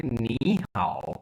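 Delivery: नी in rising second tone before a dipping हाओ; tone sandhi. Shown as a demonstration. Teacher modelling the greeting with the changed tone. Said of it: "तो इसलिए हम कहेंगे".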